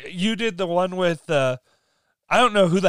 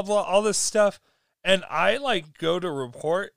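ordinary delivery

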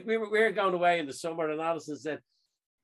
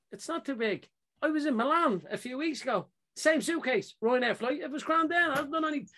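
higher-pitched retold objection; indignant protest; 10 semitones higher than usual. Traveller disputing a suitcase ruling with airline girl, indignant. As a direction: argue back in a raised, protesting pitch. Acted argument with airline staff.

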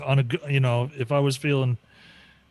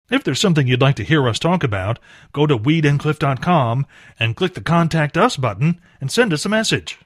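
loud upbeat announcer read; radio outro; 7 dB louder than usual. Acted. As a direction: sign off the show like a DJ.

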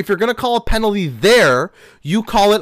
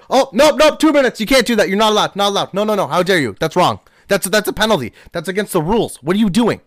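mocking fussy referee. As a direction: overplay a petty ref outraged at a tiny elbow touch. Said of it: "you see someone accidentally touch elbows".

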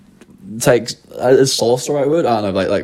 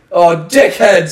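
slow, loud, higher British wail; hammed-up British lament, drawn out and loud; mock British accent; 7 semitones higher, 6 dB louder, much slower than usual. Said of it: "imagine when, like, Ahmed dies".

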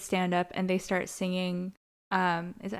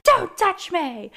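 loud, high, drawn-out retold snap; mock furious rebuff; 9 semitones higher, 7 dB louder, slower than usual. Host imitating Quinn rejecting Finn, mock furious rebuff. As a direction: push him away with a slow, raised command.

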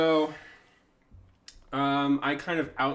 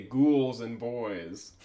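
slow; 5 dB louder, much slower than usual. Acted. Slow drawn-out pun again.

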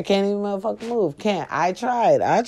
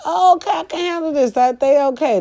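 high, quick mock-confident self; self-assured inner voice; 11 semitones higher than usual. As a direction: act out her own cocky optimism, pitched way up. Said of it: "I was like".

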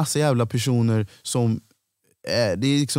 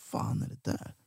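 breathless whisper to himself; shocked whisper; 13 dB quieter than usual. Retold or imitated. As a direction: whisper it in disbelief. Shocked aside in retold scene. (chockad viskning)

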